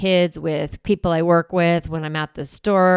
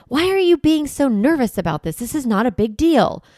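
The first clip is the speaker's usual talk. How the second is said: accusing parent; 5 semitones higher than usual. Retold or imitated. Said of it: "they threaten or they accuse their child".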